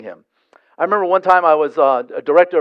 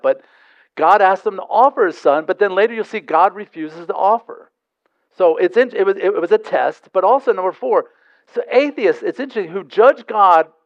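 ordinary talk

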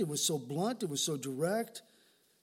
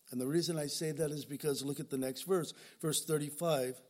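ordinary talk, nothing marked

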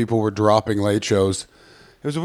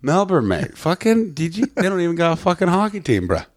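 hammed-up Canadian accent, climbing higher as it goes; 4 semitones higher than usual. Shown as a demonstration. Comic showing off to the podcast host, broad Canadian accent. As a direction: lay the Canadian accent on thick, a bit Irish and Boston. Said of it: "you've got a Canadian accent".

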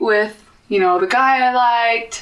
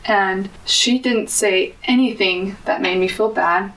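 usual speaking voice

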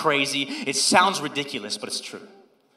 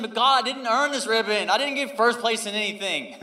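pleading protest; 6 semitones higher, 5 dB louder than usual. Retold or imitated. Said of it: "I'm saying".

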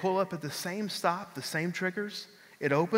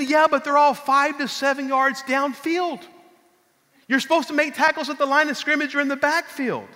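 coach scolding; 8 semitones higher, 6 dB louder than usual. Retold or imitated. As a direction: lecture him like a fired-up coach. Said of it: "he starts hollering at me".

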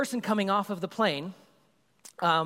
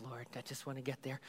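low, much softer plea to God; quiet prayer; 4 semitones lower, 15 dB quieter, faster than usual. Retold or imitated. Sweating passenger praying quietly to arrive. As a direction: breathe the prayer out, worn out.

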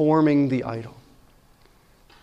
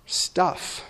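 whispered word; 9 dB quieter than usual. Acted. Preacher's pointed warning about possessions.

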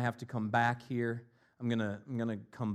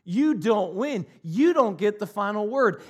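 louder, higher defiant challenge; defiant taunt; 9 semitones higher, 9 dB louder than usual. Retold or imitated. Paul defying death to its face, loud taunt. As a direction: stare death down and jeer. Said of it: "he's saying".

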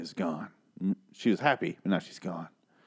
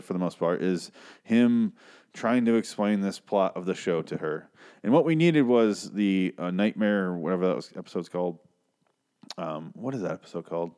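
normal talking voice

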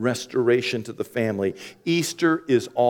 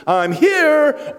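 self-important; 7 dB louder than usual. Retold or imitated. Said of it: "walk into a room one of two ways".

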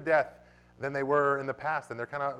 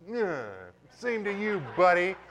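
higher, mocking "same to you, buddy"; preacher showing a defiant gesture toward death; defiant sass; 6 semitones higher, slower than usual. Shown as a demonstration. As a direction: dismiss death with a cheeky brush-off.

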